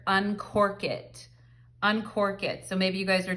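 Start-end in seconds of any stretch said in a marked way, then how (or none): 0.1-1.3 slow and drawn out, each word weighed; slow, deliberate relay of a heard phrase; slow channeled message. Retold or imitated. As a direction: repeat the message slowly, as if it were dictated to you. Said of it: "I just heard".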